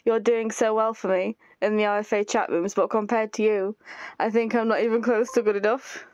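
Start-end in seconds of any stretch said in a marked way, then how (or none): none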